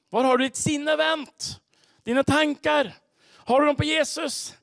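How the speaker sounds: high, loud, ringing challenge; forceful preaching. Real, not acted. Preacher challenging congregation about where their thoughts are, forceful preaching. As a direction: drive each question home at full voice.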